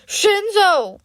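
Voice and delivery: loud, slurred tipsy call; drunk greeting. A narrator's reading. Drunk classmate greeting Hitoshi at a reunion, slurred and loud. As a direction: hail him like a happy drunk. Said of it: "his voice already slurred with alcohol".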